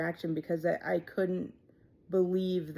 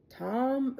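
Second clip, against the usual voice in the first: anxious guess; 7 semitones higher than usual. Retold or imitated. Guessing another name.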